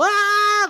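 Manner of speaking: high hammed-up girlish shriek; mock shriek of a fleeing heckler; little girl scream. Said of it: "like a screaming little girl".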